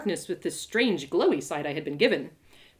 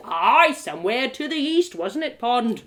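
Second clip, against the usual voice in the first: character voice; 3 semitones higher, 4 dB louder than usual. Acted. Rock character voice.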